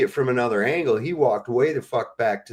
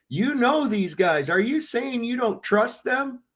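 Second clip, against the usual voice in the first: higher, slow, sarcastic imitation; mocking the defenders; 10 semitones higher, slower than usual. Mimicking people defending the nonsense, mocking and high. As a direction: parody their smug question. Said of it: "mocking defenders' argument".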